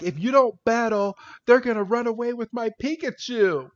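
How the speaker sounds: high-pitched, urgent warning; mock urgent plea. Podcaster retells Ash urging Florinda to battle, urgent. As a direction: plead like an anxious cartoon hero.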